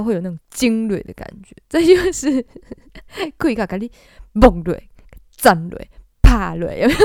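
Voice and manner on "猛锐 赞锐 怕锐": each word punched loud with a hard stop on the first syllable; 重音顿挫; heavy stop accents. Shown as a demonstration. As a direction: hit "猛锐" "赞锐" "怕锐" hard and clipped. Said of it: "重音顿的感觉".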